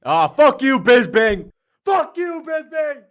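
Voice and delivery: loud, high jeering yell; mock angry shout. Real, not acted. Streamer yelling at the commentator, jeering angry shout. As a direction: yell it at the screen.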